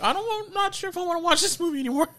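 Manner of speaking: put-on very high, soft child voice; scared little kid. Recalling watching the horror scene as a child, high scared-kid voice. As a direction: squeak it out like a frightened kid backing away. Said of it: "I was kinda scared".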